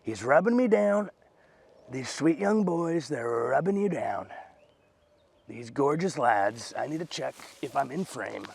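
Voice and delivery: slow, drawn-out Trump impersonation; amateur Trump voice with lingering words; Trump impression. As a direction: do a bad Trump, stretching every phrase. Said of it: "I did that like Trump".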